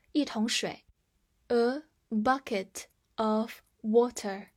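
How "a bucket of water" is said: slow, each word set apart; slow model reading. Shown as a demonstration. Teacher repeats the phrase for learners, slow and separated. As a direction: read it out word by word.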